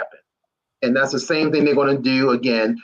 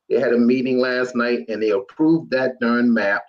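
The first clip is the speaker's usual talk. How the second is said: ordinary talk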